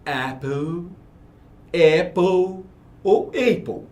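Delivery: "apple" said three ways, each drawn out slowly; slow, spaced demo of "apple", changing the first vowel each time; three pronunciations of apple. Shown as a demonstration. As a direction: draw out each "apple", a different opening A every time. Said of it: "você escuta de três maneiras".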